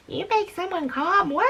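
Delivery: a hint of the husband scoffing; mock husband. Husband reacting to the comment, lightly voiced disbelief. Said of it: "He'll probably laugh".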